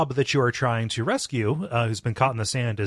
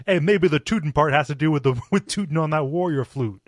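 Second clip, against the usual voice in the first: higher, louder goofy creature voice; musing platypus; 5 semitones higher, 4 dB louder than usual. Retold or imitated. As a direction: play a dopey platypus thinking aloud. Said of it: "one of them's musing".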